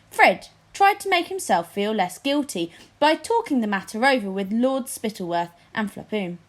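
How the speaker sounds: plain reading voice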